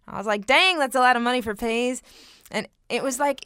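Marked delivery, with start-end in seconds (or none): 0.5-2.0 loud, fast Southern drawl of an Arkansas friend. Retold or imitated. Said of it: "slipping into the Southern drawl".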